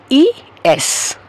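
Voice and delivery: ই এস said slowly as e-es; ই এস: stretch the e before es; spelling out the letter S. Shown as a demonstration. Teacher showing the letter S begins with a vowel. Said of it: "দিয়ে উচ্চারণ".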